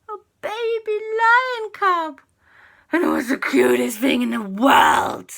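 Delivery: very high, loud adoring coo; cooing delight. A narrator's reading. Narrator revealing the lion cub, high adoring coo. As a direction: gush over something adorable.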